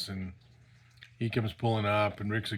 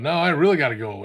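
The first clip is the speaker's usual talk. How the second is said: louder, higher mock protest; retold protest; 4 semitones higher, 10 dB louder than usual. Friend retelling his plea to leave, urgent protest. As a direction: blurt out an imitated urgent excuse.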